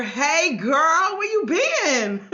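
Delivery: high, loud, delighted hollering hello; excited greeting. Real, not acted. Coach greets a viewer she has missed, excited greeting. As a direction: call out to a long-missed friend, stretching the last words.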